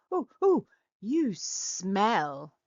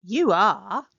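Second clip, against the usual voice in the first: louder, blunt reply; loud flat contradiction; 7 dB louder than usual. Acted. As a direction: state it firmly and loud. Other character contradicts.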